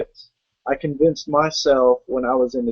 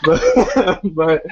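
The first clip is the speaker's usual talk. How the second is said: laughing; 4 semitones higher than usual. Real, not acted. Guest starting a sentence while both laugh.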